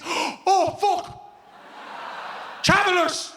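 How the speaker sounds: startled yelp of mock alarm; panicked rabbit. The rabbit hears the whippets coming, mild mock panic.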